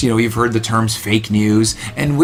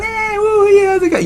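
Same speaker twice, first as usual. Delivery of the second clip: high whooping cheer; party whoop. Retold or imitated.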